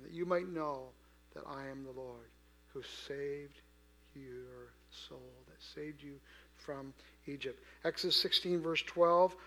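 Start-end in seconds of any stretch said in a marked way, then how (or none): 0.0-7.6 very soft, lower, with weighty pauses; hushed preaching. Real, not acted. Preacher brings God's saving home to the congregation, hushed. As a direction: let each phrase sink in quietly.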